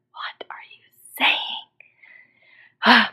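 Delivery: very slow, drawn-out scandalized whisper; hushed mock horror, every word dragged; shocked whisper. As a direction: whisper it in stretched-out disbelief. Retold shock at friend's cussing.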